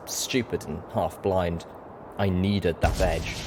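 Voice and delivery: ordinary delivery, nothing marked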